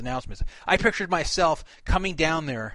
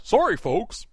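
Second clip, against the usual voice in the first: Wally World Moose voice; 3 semitones higher, slower than usual. Retold or imitated. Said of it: "like the Wally World Moose".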